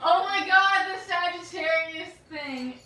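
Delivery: loud laughing outburst. Real, not acted. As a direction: crack up loudly. Bursts out laughing remembering video.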